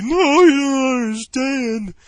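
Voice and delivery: high, loud, drawn-out mock wailing; hammed-up tearful pleading; imitated drunken crying. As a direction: bawl it out like an emotional drunk. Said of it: "He starts crying and shit".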